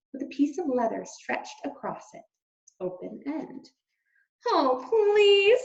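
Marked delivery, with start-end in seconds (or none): 4.5-5.6 high loud exasperated groan; fed-up wife, loud and high. Acted. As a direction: dismiss him. Antoinette dismisses husband.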